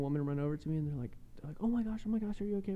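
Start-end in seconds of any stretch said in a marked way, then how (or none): 1.6-2.7 higher-pitched mock concern; lightly acted fussing stranger; worried bystander. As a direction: imitate an alarmed passerby checking on someone.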